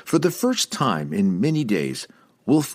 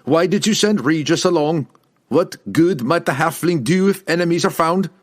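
loud, high-pitched angry demand; ferocious challenge, raised voice; 8 semitones higher, 5 dB louder than usual. A narrator's reading. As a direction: fire the questions at him accusingly. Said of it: "The ferocity of the lad's tone".

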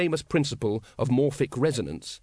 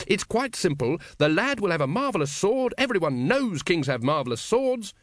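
higher, lively cult-leader explanation; lightly voiced Grand Master; 7 semitones higher than usual. A narrator's reading. Grand Master laying out his plan to the brethren, lightly voiced. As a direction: spell out the plan in a raised voice.

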